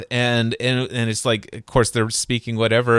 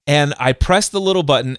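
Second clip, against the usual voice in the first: normal talking voice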